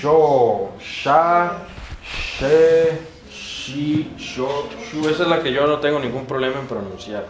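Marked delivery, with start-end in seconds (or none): 0.0-4.7 long hushed sh before each vowel; sh syllable drill. Shown as a demonstration. Teacher running through circumflex S, measured syllable drill. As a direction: recite each sh syllable separately, drawn out. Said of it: "S con circunflejo".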